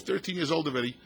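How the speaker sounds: usual speaking voice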